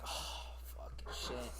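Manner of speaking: slow, unvoiced whisper; whispered curse. Caught in the office, cursing in a whisper. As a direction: breathe the curse under your breath.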